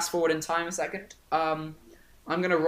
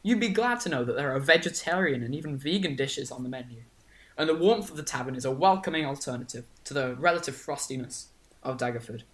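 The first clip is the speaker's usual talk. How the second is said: normal talking voice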